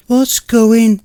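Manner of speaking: high, loud startled cry breaking off; cut-off scream. Acted. Girlfriend sees the hanging body, horrified cry. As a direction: gasp it out in horror. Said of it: "cut off abruptly to scream".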